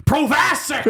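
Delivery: high, loud movie-quote yell. Retold or imitated. Podcaster mimicking a man yelling in a film, full shout. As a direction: holler it in an over-the-top impression. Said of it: "two guys yelling at each other".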